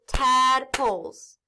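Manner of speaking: "tadpoles" in two slow beats, TAD, POLES; split into syllables. Shown as a demonstration. Teacher breaking a word in two, "tadpoles" syllable by syllable. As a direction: clap it out with a gap between tad and poles. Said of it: "when I clap the syllables".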